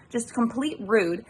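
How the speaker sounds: ordinary delivery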